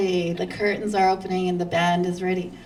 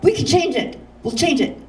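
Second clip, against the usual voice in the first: eager husband; 7 semitones higher, 4 dB louder than usual. Retold or imitated. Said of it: "my husband piped up".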